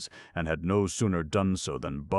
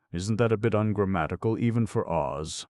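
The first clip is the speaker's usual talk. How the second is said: quick, higher lion voice; 5 semitones higher, 5 dB louder than usual. A narrator's reading. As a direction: voice the Cowardly Lion. Lion's voice in story.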